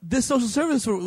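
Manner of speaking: ordinary delivery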